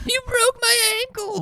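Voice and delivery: very high, laughing mock wail. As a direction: laugh while aping her fake cry. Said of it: "repeats the woman mockingly".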